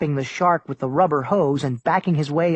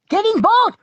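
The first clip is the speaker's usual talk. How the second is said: very high loud scream; 6 dB louder than usual. A narrator's reading. Kimmy screaming at Tuck in the water, very high loud scream. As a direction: scream the order at him. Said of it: "Kimmy screamed".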